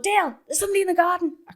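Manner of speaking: high, fast call to partner; acted alarmed call. Woman warning partner of garden intruder, acted alarmed call. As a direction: call Dale in urgent mock panic.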